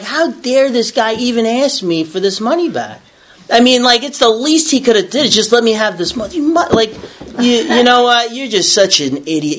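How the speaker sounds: high-pitched, hammed-up outrage; indignant inner rant. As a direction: replay a silent tirade, affronted and worked up. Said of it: "I was, like, so indignant".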